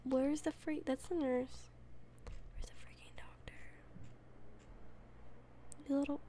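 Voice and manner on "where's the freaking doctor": unvoiced, exasperated hiss of a whisper; annoyed whisper. Real, not acted. Gamer grumbles at the missing doctor in a whisper. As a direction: mutter it under your breath, fed up.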